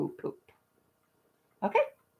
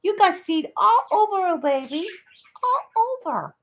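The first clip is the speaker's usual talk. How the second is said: high, louder cooing to the dog; 10 semitones higher, 4 dB louder than usual. Real, not acted. Owner cooing to her dog covered in seed, high baby talk. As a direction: coo over the mess on her.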